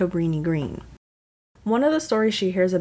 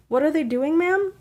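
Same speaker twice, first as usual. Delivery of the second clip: dispatcher voice; 10 semitones higher than usual. Retold or imitated. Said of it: "asked the dispatcher".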